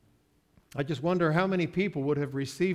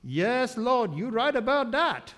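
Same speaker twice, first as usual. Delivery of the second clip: raised, higher voice with a Caribbean lilt; Caribbean accent; 7 semitones higher, 3 dB louder than usual. Retold or imitated. Preacher voicing the Caribbean lady answering Jesus, Caribbean accent. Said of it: "But my Caribbean lady says".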